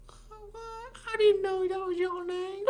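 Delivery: high, hammed-up Southern aunt voice; Southern accent. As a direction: squeal it like a surprised Southern aunt. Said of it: "She's like".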